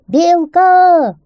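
high, loud, drawn-out calls; insistent high holler; shouting. A narrator's reading. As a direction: bellow the name across the forest. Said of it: "снова закричал он".

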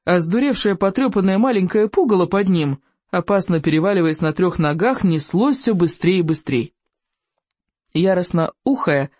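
ordinary delivery, nothing marked

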